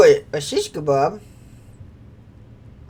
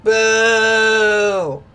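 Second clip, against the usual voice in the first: drawn-out loud boo; 3 semitones higher, 6 dB louder than usual. Real, not acted. Player booing a villain reveal on screen, drawn-out boo. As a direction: boo like a jeering crowd.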